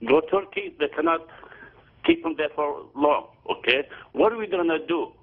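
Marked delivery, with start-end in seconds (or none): none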